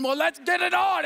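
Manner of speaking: high, heated mock challenge; retold fighting challenge. Husband taking up his wife's fight in public, mock heated challenge. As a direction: act out squaring up for a row.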